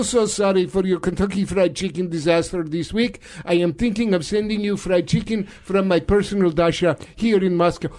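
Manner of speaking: mock Russian accent, chummy caller; Vladimir Putin. Russian leader phoning his friend Donald, mock Russian accent. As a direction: greet a comrade in a stagey Russian accent. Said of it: "I'm now talking in a Russian accent".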